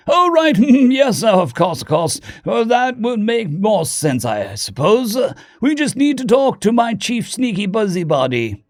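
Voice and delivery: high, bumbling upper-class British voice; posh British accent. A narrator's reading. Diplomat remembers his plan, posh British accent. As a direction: burble along like a posh British gentleman. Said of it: "The posh upper-class British accent".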